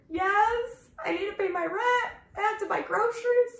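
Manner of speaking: high, tearful pleading; crying. Retold or imitated. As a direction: cry it out to the boss. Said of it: "And I was crying".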